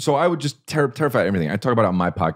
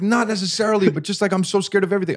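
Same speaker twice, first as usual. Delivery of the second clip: put-on much higher, whiny child voice; scared little kid; 12 semitones higher than usual. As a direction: squeak it out like a frightened kid. Scared kid answering his dad.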